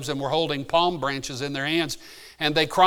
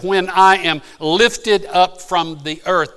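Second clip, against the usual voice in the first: when I am lifted up slow and loud; emphatic quote; 3 semitones higher, 7 dB louder, slower than usual. Acted. Preacher struck by Jesus' language, emphatic quote. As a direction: punch lifted up and stretch the phrase. Real wording after "He didn't say.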